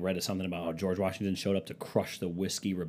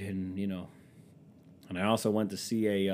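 normal talking voice

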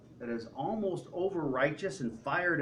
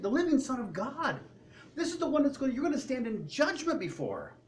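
higher, preachy fervent scolding; fired-up believer warning scoffers, higher; 9 semitones higher than usual. Retold or imitated. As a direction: warn the mockers like a zealot. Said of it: "to be like".

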